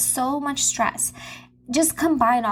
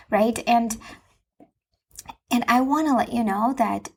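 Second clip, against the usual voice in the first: ordinary talk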